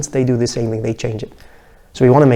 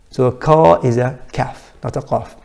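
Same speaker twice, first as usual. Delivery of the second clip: ordinary talk, nothing marked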